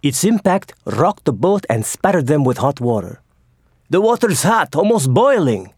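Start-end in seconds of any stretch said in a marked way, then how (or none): 3.9-5.7 higher, louder startled gasp of words. A narrator's reading. Admiral splashed by boiling water, startled gasp. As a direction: blurt it as the hot spray hits. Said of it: "gasped Pliny".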